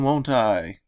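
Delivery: usual speaking voice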